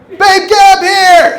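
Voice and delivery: hammed-up wife yelling from upstairs; imitated wife screaming. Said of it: "my wife screaming at the top of her lungs".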